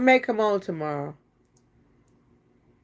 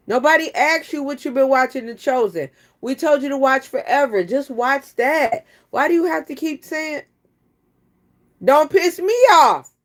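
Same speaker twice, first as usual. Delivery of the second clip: louder and higher, fed up; exasperated rant; 6 semitones higher, 6 dB louder than usual. Real, not acted. Griping at actress Megan Good, exasperated rant.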